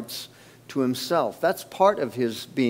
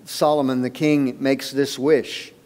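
ordinary talk, nothing marked

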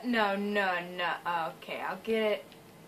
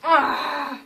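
loud, higher yelp; startled cry; 5 semitones higher, 10 dB louder than usual. Real, not acted. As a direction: cry out in alarm. Startled during game.

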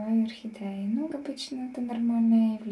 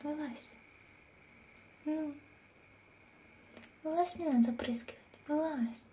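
whisper; 3 semitones higher, 6 dB quieter than usual. Real, not acted. Coaxing cat at night.

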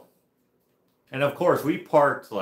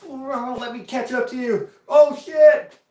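very high-pitched cry, bursting loud at the end; squealed yell that erupts into a loud curse; re-enacted panicked holler; 11 dB louder, slower than usual. Rider re-enacting the crash for listeners, high wailing holler. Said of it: "this is what it sounded like".

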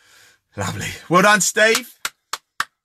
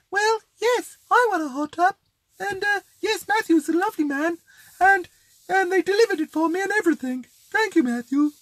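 very high, posh mock lady voice; the Queen. Karen thanking Matthew for her hot tub, hammed-up Queen voice. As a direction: squeak it out like a man imitating the Queen. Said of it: "Does Karen talk like the Queen?".

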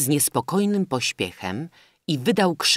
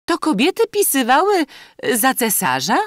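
high, loud incredulous question; society lady's high-pitched surprise; surprised lady; 13 semitones higher, 8 dB louder than usual. A narrator's reading. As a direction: ask in astonishment, voice pitched way up.

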